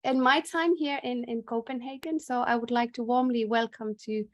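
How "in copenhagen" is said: normal talking voice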